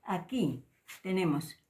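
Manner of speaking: ordinary talk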